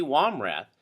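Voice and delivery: ordinary delivery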